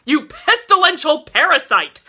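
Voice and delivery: enraged bellowed insult; abusive insult. A narrator's reading. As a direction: hurl it while shaking him. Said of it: "a lurid assortment of abusive epithets".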